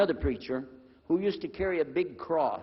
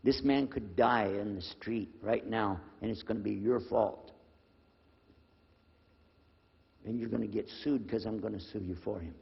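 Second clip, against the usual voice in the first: low, measured threat; retold confrontation; 6 semitones lower than usual. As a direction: warn her in a lowered, controlled voice. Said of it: "I got in the lady's face".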